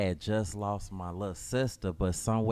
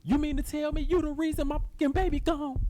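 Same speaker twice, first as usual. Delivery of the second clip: much higher, wailing accusation; mother blaming her son for her daughter's death, much higher and wailing; mama's fury. Acted. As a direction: accuse him through sobs.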